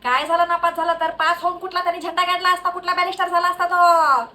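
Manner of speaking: acted mother angrily defending her child; indignant defence. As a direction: argue back in one unbroken protest. Mother defends failed son loudly.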